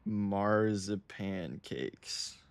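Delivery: marzipan cakes drawn out very slowly; slow, deliberate reading of a product name; slow careful repeat. Shown as a demonstration. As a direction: spell it out word by word, slowly. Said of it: "What did you say?".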